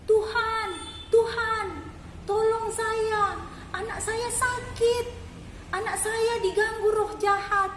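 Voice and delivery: crying out for help. A narrator's reading. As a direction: call after him begging, voice pitched high. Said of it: "berteriak-teriak minta tolong".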